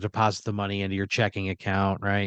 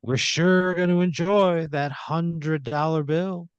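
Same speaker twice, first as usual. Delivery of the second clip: higher, slow, taunting put-on voice; smug bell ringer; 7 semitones higher, slower than usual. Bell ringer taunts Pat as he walks away, slow and smug. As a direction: drawl out the taunt like a smug villain. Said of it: "He goes".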